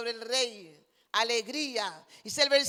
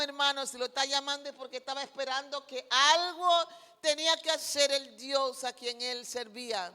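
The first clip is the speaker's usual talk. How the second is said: ordinary talk, nothing marked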